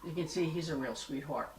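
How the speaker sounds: usual speaking voice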